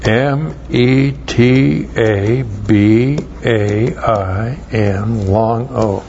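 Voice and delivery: letters "M E T A B A I N" spelled one by one, very slowly; unhurried letter-by-letter dictation; slow spelling out. Shown as a demonstration. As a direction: spell the word carefully for note-takers. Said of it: "And then the verb metabino".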